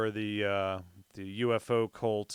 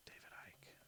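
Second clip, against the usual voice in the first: whisper; 29 dB quieter, faster than usual. Real, not acted. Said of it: "reading name to self".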